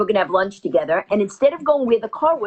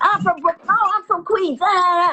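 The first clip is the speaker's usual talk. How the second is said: excited self-quote; 10 semitones higher than usual. Retold or imitated. Retelling chat, playing herself excited.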